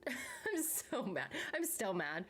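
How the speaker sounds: amused half-laughing grumble. Real, not acted. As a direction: giggle through a mock complaint. Laughing at her own anger.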